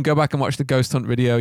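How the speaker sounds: ordinary delivery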